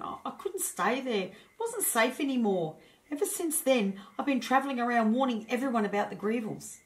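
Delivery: upset hedgehog voice. A narrator's reading. Hedgehog telling the dragon his home was destroyed, lightly upset. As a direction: tell the loss with a catch in the throat. Roger recounts losing home.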